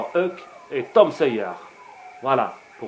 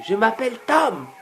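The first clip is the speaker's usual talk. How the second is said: put-on a much higher, squeaky boy voice; 10 semitones higher, 5 dB louder than usual. A narrator's reading. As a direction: pitch Tom up high like a small boy. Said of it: "il répondait avec une voix aiguë".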